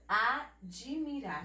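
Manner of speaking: admirar slowed, sounded "ad-ji-mirar"; "admirar" as a-dji-mi-rar, drawn out; epenthetic vowel. Shown as a demonstration. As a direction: say admirar with an audible "i" after the d. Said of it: "A gente fala admirar".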